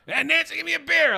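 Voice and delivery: high loud mock dad call; gruff dad demand. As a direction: bark for a beer like a lazy dad. Dad voiced in a story.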